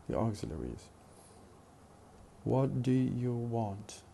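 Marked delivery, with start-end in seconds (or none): 2.4-4.0 "What do you want" said slowly, each word its own full syllable; slow careful four syllables. Shown as a demonstration. Teacher showing the full four-syllable form, slowly. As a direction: spell out all four words, unhurried. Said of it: "But in fast speech".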